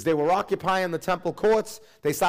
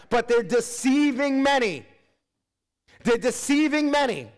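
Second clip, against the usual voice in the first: fiery preaching; 6 semitones higher than usual. Acted. Pastor urging his church about the last days, full-force preaching. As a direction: drive the point home to the congregation.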